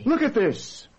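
high, cross demand; angry. Acted. Husband shows his wife the offending bill, angry. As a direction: thrust out the evidence angrily. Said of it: "Why are you so angry?".

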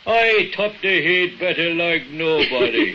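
mocking Bogdan. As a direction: mimic the rival's bragging slowly. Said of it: "That big left-footed Bogdan".

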